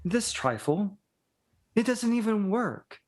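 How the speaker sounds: the Baroness scoffs at the lord's trifle, mock-haughty; scornful Baroness. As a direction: brush off the crystal ball as junk. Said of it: "he goes".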